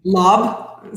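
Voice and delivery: normal talking voice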